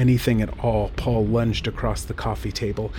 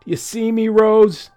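much higher, loud, shouted; drunken bellow; 11 semitones higher, 8 dB louder than usual. A narrator's reading. Drunk Paul yelling at his dead wife, bellowing. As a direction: bellow it through the house, drunk. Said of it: "he would bellow boozily".

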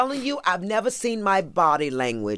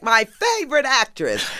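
excited greeting; 9 semitones higher than usual. Retold or imitated. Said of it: "he was like".

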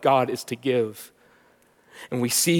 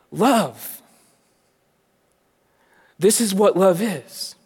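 louder, higher, driving sermon voice; full-force preaching; 6 semitones higher, 6 dB louder than usual. Acted. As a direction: preach it with rising fervor.

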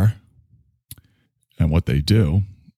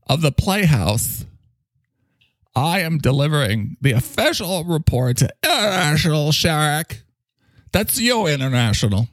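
high pompous boss voice; 7 semitones higher than usual. Acted. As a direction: play a self-important leader delivering news.

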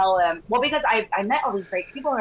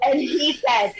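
ordinary talk

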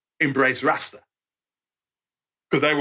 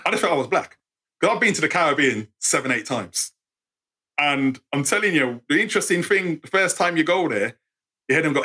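usual speaking voice

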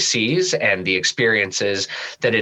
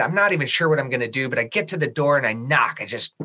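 normal talking voice

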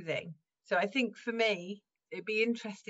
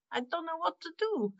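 much higher put-on voice, laugh close behind; acted admission of cluelessness; 8 semitones higher than usual. Shown as a demonstration. Improviser showing the host a toolkit trick, comic put-on helplessness. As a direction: play being stumped in a high comic voice. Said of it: "I can actually say".

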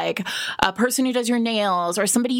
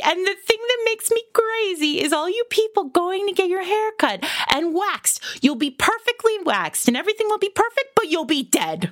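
scolding female mayor; 8 semitones higher than usual. Retold or imitated. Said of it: "And then she's like".

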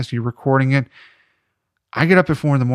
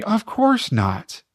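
high, drawn-out denial, put on; mock reassurance; 10 semitones higher, much slower than usual. Ex-girlfriend answering whether she faked it, mock reassuring. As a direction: play an ex-girlfriend's over-sweet fib, much higher.

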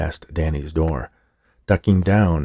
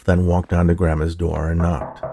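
ordinary delivery

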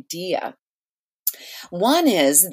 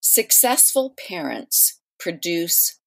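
slow and measured, pausing between phrases; slow, deliberate statement; 3 dB quieter than usual. Shown as a demonstration. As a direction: spell out the myth slowly for listeners. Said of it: "I'll say it slowly".

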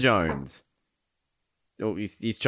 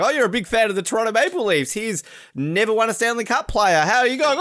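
high, fast mock interviewer; 9 semitones higher, 4 dB louder than usual. Imagining a host grilling a Canadian, high and fast. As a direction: rattle off the fake host question.

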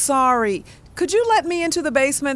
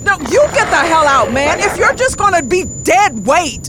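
loud, fast angry yell; furious shout; 5 semitones higher, 7 dB louder than usual. Acted. Lily yelling back at Wes, furious. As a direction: shout him down, louder and faster.

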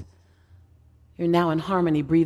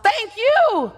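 yelled thanks; 11 semitones higher than usual. Pretend. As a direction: shout it up to the stage. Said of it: "another person from seat six hundred and sixty yells out".

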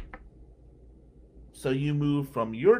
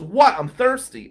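1 defensive snap; 8 semitones higher, 12 dB louder than usual. Retold or imitated. Lawyer caught at broken fountain.

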